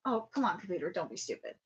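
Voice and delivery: usual speaking voice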